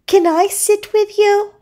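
high-pitched seahorse question; friendly seahorse. A narrator's reading. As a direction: ask hopefully in a high little voice. Seahorse gently approaches Puffy.

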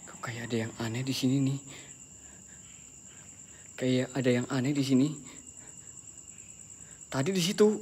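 soft, low, uneasy murmur; nervous hushed voice in the dark. Real, not acted. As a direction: keep it hushed so nothing hears. Senses something strange, hushed.

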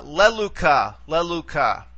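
leluca, accented on the opening "le"; stress on antepenult. Shown as a demonstration. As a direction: hit the first syllable of "leluca". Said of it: "bring it all the way back to the antepenult".

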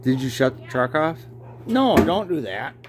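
ordinary talk

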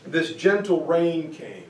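ordinary delivery

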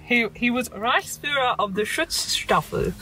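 attempted German accent, taken slowly. Shown as a demonstration. Co-host tries out Nazi titles, slow German accent. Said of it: "Trying to get my German accent on there".

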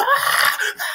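very high mock cry of pain; imitated screaming. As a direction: scream as if being whooped, retold. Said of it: "then you heard the screaming".

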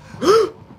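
sharp, high gasp of fright; sudden scared intake of breath; startled gasp. Real, not acted. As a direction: suck in air loudly in shock. Startled by something in game.

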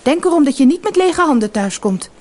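high, loud cross warning; grumpy wife. A narrator's reading. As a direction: scold sharply as a fed-up wife. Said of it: "zei de vissersvrouw knorrig".

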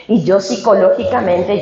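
usual speaking voice

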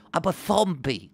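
high mock Madrid accent with a heavy lisp; lisped comic Madrid Spanish accent. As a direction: fake a Madrid accent, lisping into th. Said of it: "we have to do a Madrid accent".

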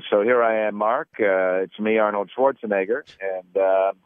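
mock plain American voice, accent gone; regular voice. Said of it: "I will talk in my regular voice".

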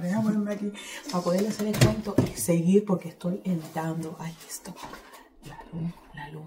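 hushed aside while editing; whisper. Real, not acted. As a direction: whisper so nobody wakes up. Talking quietly while editing.

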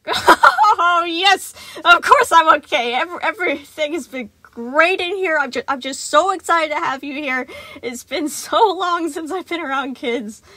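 loud, high, overly bright; forced cheer. A narrator's reading. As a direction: sell it too hard, laughing. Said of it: "a bit too loudly".

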